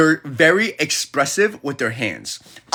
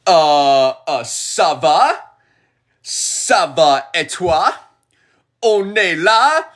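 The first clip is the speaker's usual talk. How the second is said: slow, put-on French accent; French show-off; slower than usual. Shown as a demonstration. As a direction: show off your French slowly. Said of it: "Check this out".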